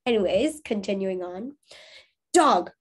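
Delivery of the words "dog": firm, raised call to a dog; stern call. A narrator's reading. Young man calling his straying dog, sternly. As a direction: sternly call out, a bit louder. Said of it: "a voice called sternly".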